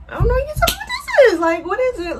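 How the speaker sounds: squeaky fake-innocent imitation of him; mocking his denial. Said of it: "chuckling like".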